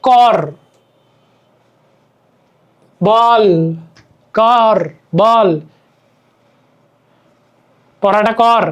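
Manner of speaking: কর and বল ending on the bare consonant; কর, বল drawn out with no vowel at the end; silent final vowel. Shown as a demonstration. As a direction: say কর as "kawr", বল as "bawl". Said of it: "অধ্বনি সাধারণত উচ্চারিত হয় না".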